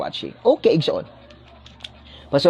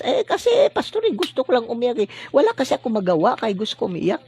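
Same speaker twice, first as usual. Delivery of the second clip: high-pitched, hurried mock excuse; imitated congregant's excuse; 5 semitones higher, much faster than usual. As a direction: play a congregant making a lame excuse, pitched up. Imagined congregant's excuse to the pastor. (nagdadahilang miyembro, mataas ang boses)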